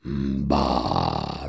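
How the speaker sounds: very low, lazy creature grunt; caterpillar grunt. Acted. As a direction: grunt back low and bored. The caterpillar answers.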